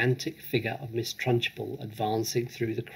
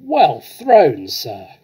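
very loud, much higher cheer; distant shout; 3 semitones higher, 10 dB louder, much slower than usual. Acted. Onlooker cheering the throw from afar, loud shout. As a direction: call it out across the playground. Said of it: "someone shouted from across the playground".

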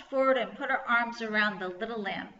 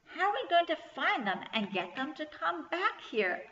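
higher-pitched, mildly worried question; 7 semitones higher than usual. A narrator's reading. Kirsty about lambs wandering the farm, worried. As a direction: fret aloud in a raised voice.

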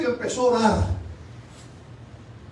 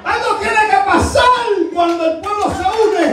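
high, urgent pulpit shout; full-force preaching; 6 semitones higher than usual. Real, not acted. Preacher urges the church to cry out, at full force. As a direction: drive each line out to the whole church.

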